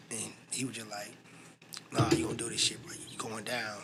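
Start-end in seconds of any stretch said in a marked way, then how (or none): 1.9-3.8 hushed, voiceless push from a friend; whispered urging. Retold or imitated. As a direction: lean in and whisper the dare. Friend urging him, whispered quote.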